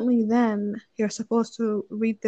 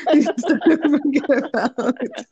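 slow, louder words through laughter; laughing; 5 semitones higher, 6 dB louder, slower than usual. Real, not acted. Telling viewers to let it go, laughing. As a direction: laugh your way through the advice. Laughing while giving advice.

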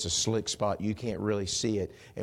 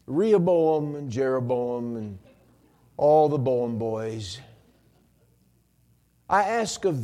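slow, pompous mock praying; mock formal prayer; 5 semitones higher, 6 dB louder, slower than usual. Preacher parodying a long prayer during a crash, mock-solemn. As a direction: drag out the lofty prayer language.

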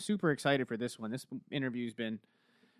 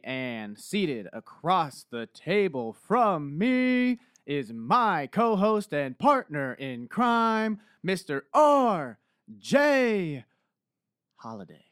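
slow, drawn-out, big announcer build; announcer intro; 6 semitones higher, 9 dB louder, much slower than usual. Pretend. Host introducing his co-host in announcer style. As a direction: stretch it like a ring announcer. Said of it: "That was a great intro".

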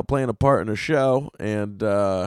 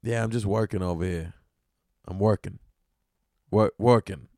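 trying a Boston accent; 4 semitones lower, 3 dB quieter than usual. Shown as a demonstration. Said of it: "what would be a Boston thing to say".

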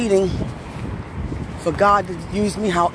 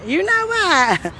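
stretched slow and higher; slow, high, drawn out; 9 semitones higher, much slower than usual. Shown as a demonstration. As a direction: drag it out slowly.

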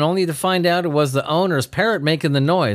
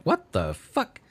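soft imitated dog; 7 dB quieter, much slower than usual.

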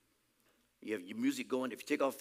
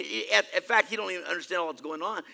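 ordinary delivery

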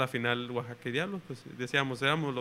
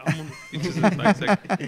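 laughing; 4 semitones higher, 7 dB louder than usual. Real, not acted. Joking about borrowing spikes.

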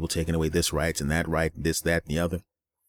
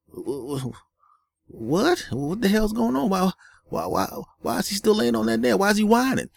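much higher, outraged disbelief; incredulous friend. Retold or imitated. Passerby asks his porch friend why the dog stays, in high disbelief. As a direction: squawk it like you can't believe your ears. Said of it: "And he goes".

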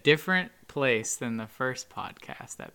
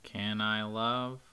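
fading robot; 7 semitones lower, 13 dB quieter than usual. Pretend. Sad robot bit, fading.

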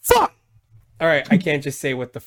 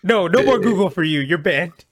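high, loud mock-annoyed search engine; a hammed-up Google banning its user; fed-up Google; 7 semitones higher, 6 dB louder than usual. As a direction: shoo the user away, exasperated. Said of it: "Google's gonna just send you to Bing and be like".

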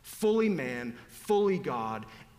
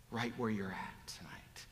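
hushed, intimate whisper; 6 semitones lower, 9 dB quieter than usual. Real, not acted. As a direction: lean in and whisper it. Preacher whispers to congregation.

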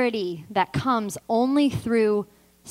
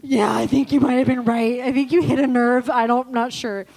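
loud blubbering, strained voice; mock sobbing reply; 5 dB louder than usual. Client telling counselor she hit a nerve, mock sobbing. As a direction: act out talking through heavy crying.

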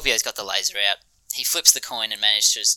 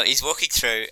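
ordinary talk

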